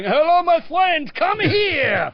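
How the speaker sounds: high, slow, exaggerated French welcome; a footballer hamming up a big welcome; imitating Ginola. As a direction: throw your arms open and call him over, slow and high. Ginola greeting with arms up.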